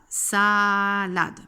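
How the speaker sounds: "saaa-lade", clearly split; two syllables. Shown as a demonstration. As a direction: count out "Salade" in two drawn-out beats. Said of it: "Il y a deux syllabes".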